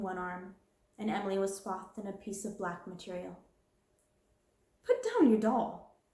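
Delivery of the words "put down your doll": sharp, slow order to put the doll down; stern command. Acted. Stern command. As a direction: headmistress scolding a girl, stern and loud. Headmistress scolds orphan girl.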